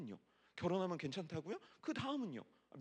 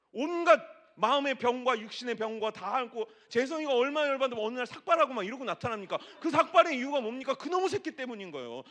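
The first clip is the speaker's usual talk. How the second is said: louder, laughing sermon build; lively preaching; 6 semitones higher, 9 dB louder than usual. Acted. Pastor joking with the congregation, louder and laughing. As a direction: ride the crowd's laughter.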